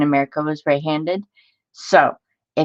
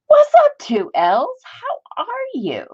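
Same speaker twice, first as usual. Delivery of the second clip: sudden much higher, sing-song hello; high greeting; slower than usual. Real, not acted. Greeting a live viewer mid-rant, in a much higher sing-song voice. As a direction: chirp a bright hello to a viewer.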